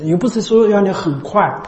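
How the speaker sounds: ordinary delivery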